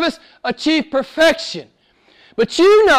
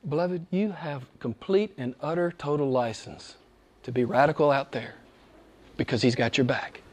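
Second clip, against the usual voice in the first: much softer, lower and tender; soft and intimate; 9 semitones lower, 12 dB quieter than usual. Acted. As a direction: draw them close with a hushed voice.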